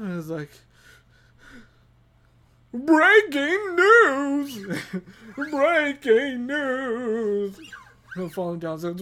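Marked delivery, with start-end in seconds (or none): 2.7-7.6 very slow, loud booming announcement; hammed-up cartoon newscaster; SpongeBob news anchor. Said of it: "bass anchor from SpongeBob".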